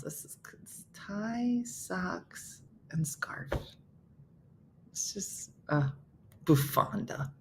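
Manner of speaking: whispered word list read under her breath; whispering to herself. Real, not acted. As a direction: murmur the words softly to yourself. Quietly reading puzzle words to herself.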